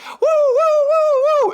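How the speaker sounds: high loud woo-woo siren; police siren. Retold or imitated. Comedian mimics the tape's siren, high and loud. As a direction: wail it like a cop car siren.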